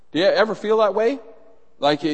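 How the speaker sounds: normal talking voice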